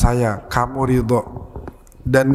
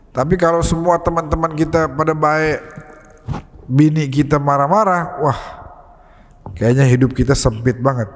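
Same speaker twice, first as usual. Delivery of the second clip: ordinary delivery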